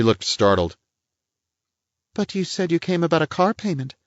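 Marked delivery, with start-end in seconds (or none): 2.1-4.0 a higher-voiced prim society lady; lady's voice, pitched up and a little pert; voiced woman. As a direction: play the indignant lady of the house. Woman figure's lines.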